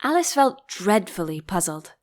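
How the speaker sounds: ordinary delivery, nothing marked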